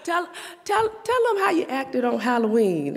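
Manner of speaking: high, laughing mock voice of God; God teasing, laughing. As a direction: tease through laughter. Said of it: "And, and God was like".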